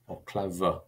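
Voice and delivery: ordinary talk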